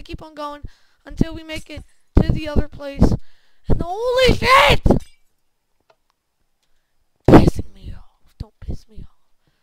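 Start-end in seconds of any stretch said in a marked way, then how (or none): 3.7-4.8 high, loud, slow holy shit; excited exclamation. Real, not acted. Gamer reacting to a close jump. 7.3-9.5 hissed whisper of frustration; angry whisper. Real, not acted. Gamer whispering his anger at the game. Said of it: "unvoiced whisper".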